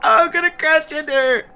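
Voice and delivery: high giggly voice; laughing. Real, not acted. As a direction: talk through laughter. Laughing, high excited talk.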